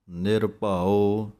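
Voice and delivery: ਨਿਰਭਉ very slow, the ਉ sounded clearly; correct pronunciation. Shown as a demonstration. Teacher models ਨਿਰਭਉ for students, slowly. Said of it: "ਨਿਰਭਉ ਪਾਠ ਬੋਲਣਾ ਹੈ".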